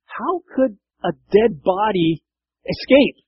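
slow, high, disbelieving guard voice; baffled guard. Retold or imitated. Roman guard finding the tomb empty, slow baffled. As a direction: puzzle it out like a dumbstruck soldier. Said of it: "I can see him like".

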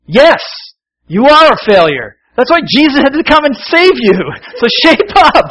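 wife scolding her husband out loud; fed-up wife. Retold or imitated. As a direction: belt it like a wife who has had enough. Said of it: "May got fed up with me".

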